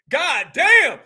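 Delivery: a loud, drawn-out exclamation; loud god damn. Real, not acted. Host hearing about the bullets, blurting it loud. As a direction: let it burst out of you. Reacting to the clip.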